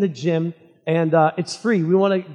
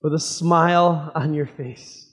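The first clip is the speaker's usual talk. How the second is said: prayer. Acted. Pastor leads opening prayer.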